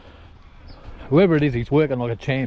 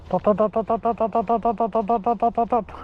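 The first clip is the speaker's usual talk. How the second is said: quick high repeated dot, dot, dot; rhythmic dog call; 9 semitones higher than usual. Real, not acted.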